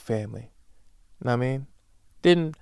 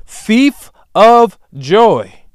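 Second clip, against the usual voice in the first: slow, loud, high cry of thief of joy; a drawn-out, loud thief of joy; yelled accusation; 8 semitones higher, 13 dB louder, much slower than usual. Real, not acted. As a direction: bellow it out like a verdict. Rant climax repeated.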